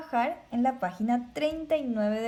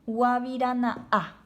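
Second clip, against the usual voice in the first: guavirana'a with a small break before the last a; slow guavirana'a, stop briefly between the two a's; teacher showing the pause in guavirana'a; pause at the glottal stop; 3 dB louder, slower than usual. Shown as a demonstration. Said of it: "yo hago una pequeña pausa".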